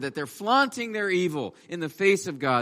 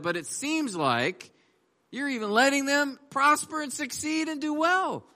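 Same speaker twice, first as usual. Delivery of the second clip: higher, pleading complaint to God; Asaph's protest; 6 semitones higher than usual. Acted. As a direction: cry out in disbelief at injustice. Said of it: "He's like saying".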